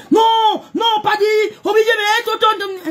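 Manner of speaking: high, loud excited calling out; shouting to someone off the live video. Real, not acted. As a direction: yell the names out in a burst of excitement.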